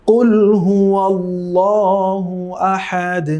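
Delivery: কুল হুয়া আল্লাহু আহাদ drawn out and chanted; slow recitation. Shown as a demonstration. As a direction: recite slowly in tilawat melody. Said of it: "আমরা একসাথে তিলাওয়াত করছি". (ধীর সুরেলা তিলাওয়াত)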